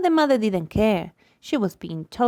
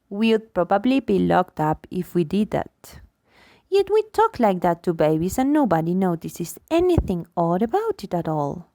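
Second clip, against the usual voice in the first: ordinary delivery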